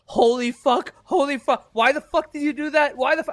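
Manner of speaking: high-pitched outrage at the TV, not full volume; retold screaming. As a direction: reenact yelling at the screen in disbelief. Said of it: "I was screaming at my telephone".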